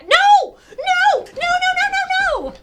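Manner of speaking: very high, loud screaming; screamed protest. Real, not acted. Streamer dreading his return, screamed protest. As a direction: scream a frantic string of no's.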